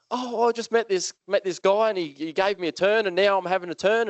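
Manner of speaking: put-on high, excited boy's voice; excited kid. A boy tells the teacher about his turn with the cane, excited. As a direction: gush like a proud little kid.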